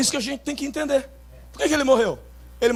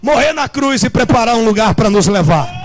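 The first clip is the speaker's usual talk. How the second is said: loud, rising shouted proclamation; fervent preaching shout; 4 semitones higher, 6 dB louder than usual. Acted. Pastor proclaiming Jesus' work to the congregation, shouting. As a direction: build to a triumphant shout.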